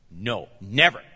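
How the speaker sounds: a low, firm no from Peter; low stubborn refusal; Peter's flat refusal. Acted. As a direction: refuse outright, digging in. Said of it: "I wonder what his voice was like".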